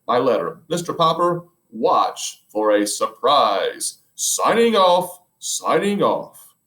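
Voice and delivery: an explorer broadcasting from the South Pole; Admiral Drake on the radio. Acted. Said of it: "a faint voice floated out".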